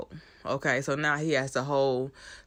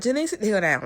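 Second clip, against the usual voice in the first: higher exasperated order; 6 semitones higher, 3 dB louder, faster than usual. Retold or imitated. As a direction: tell her off sharply. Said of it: "Ava's like".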